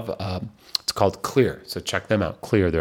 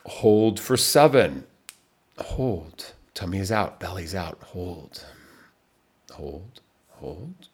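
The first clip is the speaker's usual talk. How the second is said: calm counting coach, nearly whispering by the end; guided breathing; 3 semitones lower, 6 dB quieter than usual. Acted. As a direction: talk them through each hold gently, softer and softer. Leads a timed breathing exercise.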